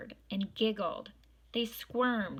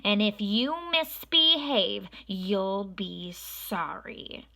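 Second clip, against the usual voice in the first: loud then slow menacing warning; threat; 5 dB louder, slower than usual. A narrator's reading. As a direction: threaten the children, drawing it out. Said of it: "said Miss Swamp".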